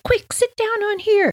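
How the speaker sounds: put-on very high quick kid voice; urgent sister. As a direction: hurry the little one in a high voice.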